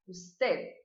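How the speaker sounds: "usted" with the final D barely sounded; soft final D. Shown as a demonstration. Teacher demonstrating the final D. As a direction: end "usted" lightly on the D. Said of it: "Viu que ela ficou aqui parada?".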